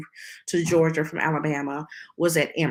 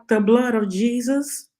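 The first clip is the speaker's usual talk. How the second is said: raised, louder questioning cry; retold shock, higher and rising at the end; incredulous exclamation; 6 semitones higher, 3 dB louder than usual. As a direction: exclaim it in disbelief, pitch climbing. Retells her appalled reaction to doctor.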